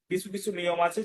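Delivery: ordinary talk, nothing marked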